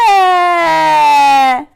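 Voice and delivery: long, high wailed short A; mock baby wail on a short vowel; pretend baby cry. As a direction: hold a short A like a bawling baby. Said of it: "pretend like it's a baby crying".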